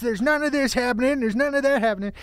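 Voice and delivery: high, hammed-up movie cop line; mock cop in terrible ADR; bad dubbed-in cop. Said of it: "this really bad A D R".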